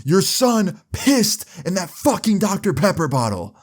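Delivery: high, outraged accusation; imitated yell. Joey telling on Kyle to his mom, imitated outraged yell. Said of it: "Joey yells at his mom".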